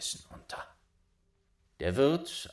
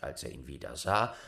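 plain reading voice

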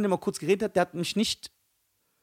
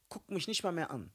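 hushed hurt; 4 semitones lower, 12 dB quieter than usual. Real, not acted. Recalls being ignored by his idol.

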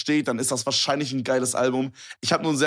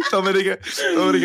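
laughing out the name twice; cracking up, calling his friend's name; giggling Dominik, Dominik through laughter; 5 semitones higher, 4 dB louder than usual. Real, not acted.

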